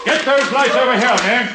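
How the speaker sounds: calling out. Acted.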